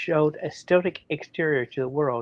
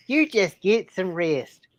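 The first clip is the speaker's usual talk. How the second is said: Southern accent; 6 semitones higher, slower than usual. Shown as a demonstration. Reread in Southern voice.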